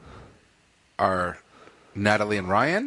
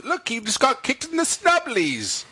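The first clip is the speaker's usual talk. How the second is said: put-on British accent; 12 semitones higher than usual. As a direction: do a posh British bit. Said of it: "So this is for British people".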